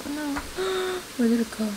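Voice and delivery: high, soft "oh no" with gasp; surprised gasp. Real, not acted. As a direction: catch your breath in alarm. Something spilled while cooking.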